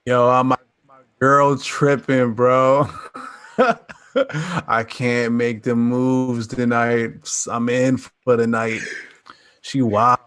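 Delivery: a hint of a slow, sheepish bro excuse; lightly voiced bro excuse. Friend backing out of Friday plans, sheepish. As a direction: slowly make a lame excuse.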